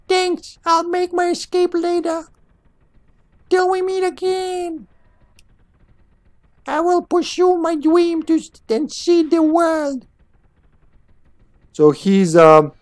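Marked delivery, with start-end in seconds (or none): 0.1-10.1 very high, squeaky little creature voice; Pip voice. Acted. Player voicing a little creature's dream, squeaky high. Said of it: "I need a good voice for him".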